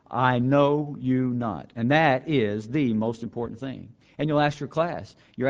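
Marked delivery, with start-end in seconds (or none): 0.1-1.7 I know you not drawn out slow, falling at the end; emphatic quote. Acted. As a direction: weigh every word of I know you not. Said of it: "that's the emphasis that Christ gives us".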